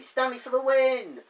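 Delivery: loud, high fan cheer; rousing cheer. Real, not acted. Reviewer championing Stanley, loud excited cheer. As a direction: cheer your favourite on loudly.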